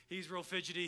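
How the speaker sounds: ordinary talk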